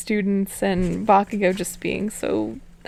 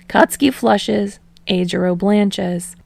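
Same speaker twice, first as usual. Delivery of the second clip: ordinary delivery, nothing marked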